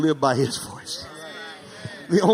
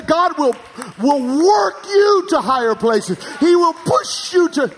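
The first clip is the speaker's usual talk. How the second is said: high, loud preacher's cry building up; full-force preaching; 8 semitones higher, 4 dB louder than usual. Acted. Pastor urging the congregation toward change, in a loud preaching cry. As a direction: preach it out and lift the room.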